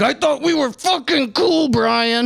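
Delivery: hammed-up betrayed friend; mock betrayed. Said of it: "Oh, I was like".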